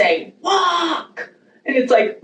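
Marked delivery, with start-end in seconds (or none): 0.4-1.3 high mock lifeguard call, not truly loud; lifeguard's command. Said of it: "lifeguard blowing the whistle super loud, pointing at you directly".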